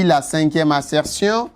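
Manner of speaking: normal talking voice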